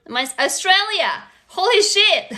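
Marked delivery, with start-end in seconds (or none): 1.5-2.3 high-pitched delighted astonishment; amazed. Real, not acted. Host seeing a viewer from Australia, amazed delight. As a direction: exclaim it, pitch leaping up.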